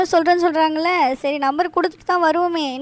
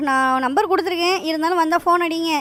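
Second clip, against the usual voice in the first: ordinary talk, nothing marked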